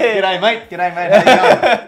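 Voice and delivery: loud, high, hammed-up Australian greeting; Aussie accent. Shown as a demonstration. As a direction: throw out a big Australian hello. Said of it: "turn on my Aussie accent".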